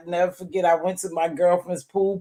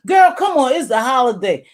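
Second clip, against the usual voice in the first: loud, high coaxing party friend; coaxing friend shout; 5 semitones higher, 7 dB louder than usual. Retold or imitated. Friend pushing her to smoke, loud coaxing shout. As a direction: egg her on at the top of your voice. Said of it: "And I'm like".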